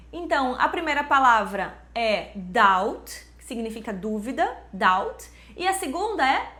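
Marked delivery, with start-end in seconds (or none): 2.4-3.3 "doubt" as "dowt", rising at the end; silent B. Shown as a demonstration. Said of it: "simplesmente não é pronunciada". 4.7-5.3 "doubt" as "dowt", low then rising; silent B. Shown as a demonstration. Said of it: "simplesmente não é pronunciada".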